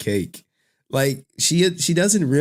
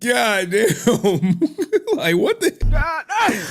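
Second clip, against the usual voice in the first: laughing disbelief; 11 semitones higher, much slower than usual. Real, not acted. Reacting to rant clip.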